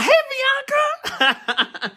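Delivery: high, squealed hello with laughter; excited greeting. Real, not acted. Host spots a viewer joining, excited squealed greeting. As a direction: call out to a friend in a laughing high pitch.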